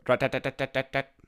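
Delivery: woodpecker tapping. Retold or imitated. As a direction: hammer it out like a woodpecker. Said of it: "sounds like a little hammer".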